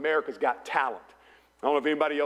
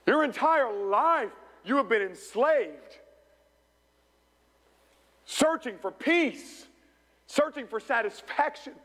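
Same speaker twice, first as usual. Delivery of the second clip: higher-pitched, measured sermon delivery with weighty pauses; emphatic preaching; 5 semitones higher than usual. Real, not acted. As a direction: drive each phrase home to the congregation. Preaches to congregation.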